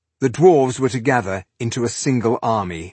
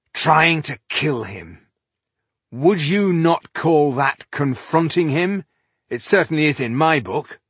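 raised, higher, indignant argument; angry retort; 7 semitones higher, 4 dB louder than usual. A narrator's reading. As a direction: demand an answer in fury. Furious leader argues back.

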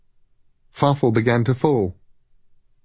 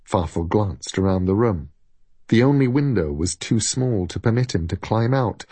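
usual speaking voice

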